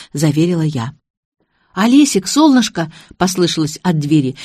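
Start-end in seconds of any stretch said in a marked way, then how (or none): 1.7-2.9 high, louder affectionate hail; fond calling out. A narrator's reading. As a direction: call to a darling from the door. Sister calls fondly from the door.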